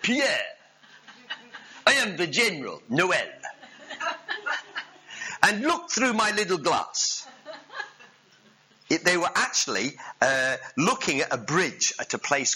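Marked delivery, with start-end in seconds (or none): 0.0-7.3 hammed-up French general, pitched high; comic French accent, grandly introducing himself. French general introducing himself to his gunner, hammed-up French accent. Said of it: "French accent, come on".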